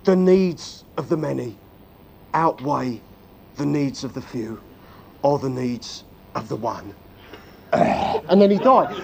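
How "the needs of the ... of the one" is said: Spock's dying words. Retold or imitated. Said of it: "he says".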